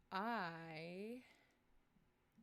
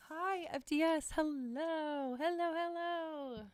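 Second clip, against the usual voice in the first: high, loud sing-song hellos; excited greeting; 9 semitones higher, 7 dB louder, slower than usual. Real, not acted. Streamer greeting a new viewer in chat, sing-song. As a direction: call out hello with delight.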